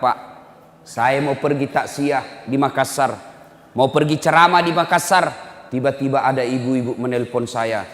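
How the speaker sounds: ordinary talk, nothing marked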